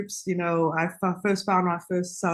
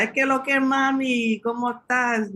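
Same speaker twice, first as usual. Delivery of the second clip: Dominican street greeting; 8 semitones higher than usual. Retold or imitated. Man greeting her in Dominican Spanish.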